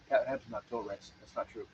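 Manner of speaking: normal talking voice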